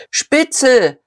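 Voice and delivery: high, sharp accusing cry; screeched. A narrator's reading. Heckler behind Diederich denouncing him, screeched. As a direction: screech the insult at his back. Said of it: "kreischte jemand".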